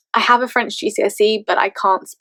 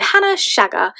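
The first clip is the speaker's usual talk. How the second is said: teacher announcing; 9 semitones higher than usual. Retold or imitated. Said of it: "she said".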